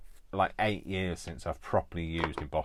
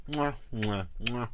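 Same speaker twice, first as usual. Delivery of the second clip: blowing kisses; 3 semitones higher, slower than usual. Real, not acted.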